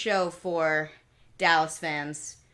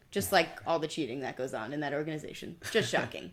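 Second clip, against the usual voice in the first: sarcastic; 5 semitones lower, 5 dB quieter than usual. Acted. Mock shock at Patriots cheating.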